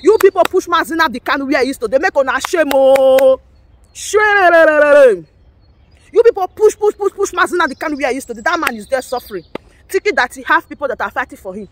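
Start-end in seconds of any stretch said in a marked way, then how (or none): 2.7-5.3 long rolled loud shaaame; drawn-out shame cry. Real, not acted. Jeering at agitators with a long loud shame. 6.1-8.4 heated accusation. Real, not acted. Blaming agitators for Kanu's jail, heated and fast.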